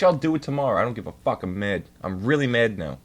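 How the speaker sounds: normal talking voice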